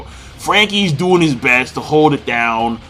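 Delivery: ordinary delivery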